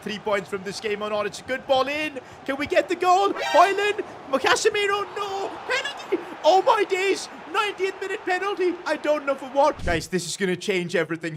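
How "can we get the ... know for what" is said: high, loud rapid shouting; frantic excitement. Real, not acted. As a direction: yell it out as the penalty is given. Late corner wins a penalty.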